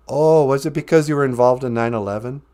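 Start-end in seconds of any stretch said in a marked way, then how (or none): none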